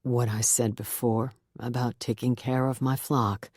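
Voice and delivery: low, soothing pastor voice; gentle reverend. A narrator's reading. Reverend welcoming Beth into his flock, soothing. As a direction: promise her a place, gently.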